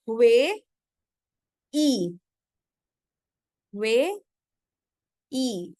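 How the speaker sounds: वे and ई as separate syllables with long pauses; isolated syllables वे ... ई for learners to follow; syllable demo. Shown as a demonstration. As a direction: say वे, wait, then ई. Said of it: "इसको भी क्या पढ़ेंगे?".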